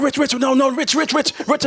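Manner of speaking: a hint of a worried friend calling him off; pleading friend.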